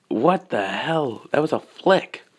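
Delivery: stretched-out, incredulous reaction; amazed disbelief. Acted. Onlookers react to the ten-thousand-meter flick, incredulous. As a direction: draw it out in astonishment.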